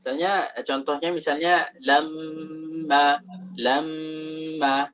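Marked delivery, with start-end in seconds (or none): 1.8-4.9 "lamma" with the mm hummed long; lengthened nasal. Shown as a demonstration. Teacher showing the long nasal hum, slow "lamma". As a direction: stretch the nasal mm of "lamma" instead of stressing it. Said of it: "panjangkan saja gunnahnya".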